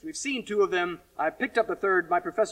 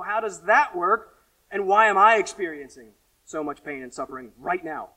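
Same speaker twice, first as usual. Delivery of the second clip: louder, driving preacher voice; forceful preaching; 2 semitones higher, 7 dB louder than usual. Acted. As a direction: press each question on the crowd.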